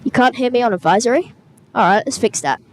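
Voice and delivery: ordinary talk, nothing marked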